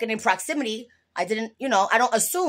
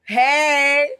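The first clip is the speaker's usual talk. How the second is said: a loud, higher "hey" of delight; pleased exclamation; 4 semitones higher, 5 dB louder than usual. Real, not acted. Pleased with the joke, calls out loud. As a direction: cheer for your own joke.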